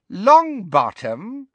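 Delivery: high, loud, drawn-out question; Bellatrix. A narrator's reading. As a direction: stretch the name with wicked relish. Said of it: "repeated Bellatrix".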